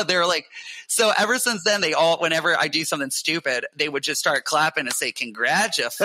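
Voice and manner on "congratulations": taunting word that breaks into laughter; mimicked congratulations dissolving into real laughs; laughing mock congratulations. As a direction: imitate a teasing cheer, then crack up.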